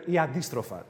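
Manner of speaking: ordinary talk, nothing marked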